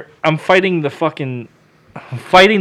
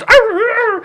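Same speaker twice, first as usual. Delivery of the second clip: very high-pitched mock dog yelping; imitated dog yelps; slower than usual. Wiener dog picked up by cousin, imitated yelping. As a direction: yelp like a small dog. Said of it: "The dog yelps".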